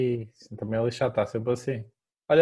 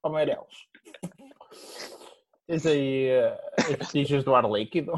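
workman voice; 4 dB quieter than usual. Retold or imitated. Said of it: "tens de falar assim".

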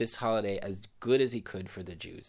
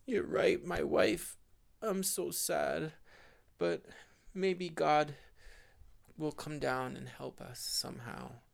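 put-on higher, sorrowful old man's voice; sad old husband; 7 semitones higher than usual. Husband answering his crying wife, higher sad voice. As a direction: comfort your wife, sadly hopeful.

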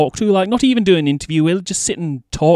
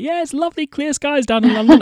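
higher, brighter TV forecaster sing-song; mock the London weather report with a bright higher lilt; cheery weather presenter; 9 semitones higher, 4 dB louder than usual. Host mimicking the TV weather forecast, light mock presenter voice. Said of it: "they'll be like".

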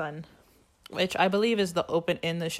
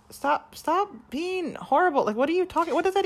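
whiny pleading; 11 semitones higher than usual. Retold or imitated. Kurt begs J D who points a gun, high whine. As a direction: plead like a scared jock. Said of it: "Kurt's like".

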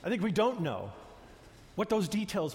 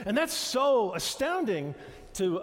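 normal talking voice

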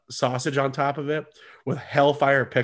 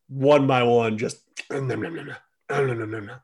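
nom nom eating sounds. Retold or imitated. Imitating eating ribs.

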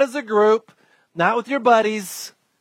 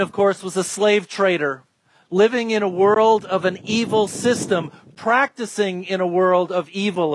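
ordinary delivery

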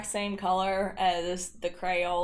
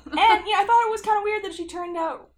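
higher and louder, fast; excited; 12 semitones higher, 11 dB louder than usual. Real, not acted. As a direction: tell it with giddy energy. Excited absurd story.

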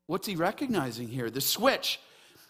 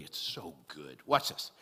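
ordinary talk, nothing marked

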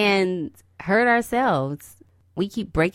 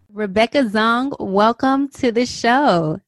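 warm welcome; 5 semitones higher, 6 dB louder, slower than usual. Real, not acted. Welcoming the guest.

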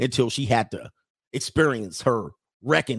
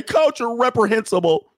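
high, louder indignant voice; mock outraged woman; 9 semitones higher, 5 dB louder than usual. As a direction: parody an offended woman. Mocking women's outrage.

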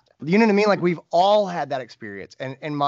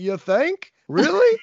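sarcastic, much higher and louder; sarcastic retort; 7 dB louder, slower than usual. Retold or imitated. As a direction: fire back with biting sarcasm.

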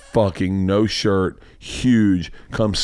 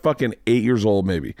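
ordinary talk, nothing marked

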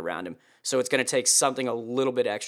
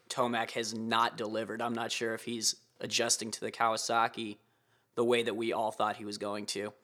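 normal talking voice

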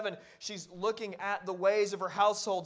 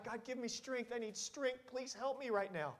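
mock crying out; 4 semitones higher, 5 dB quieter than usual. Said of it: "crying out".

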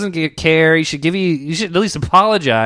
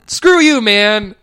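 angry; 7 semitones higher, 4 dB louder, much slower than usual. Retold or imitated. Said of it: "I'm like".

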